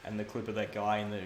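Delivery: ordinary delivery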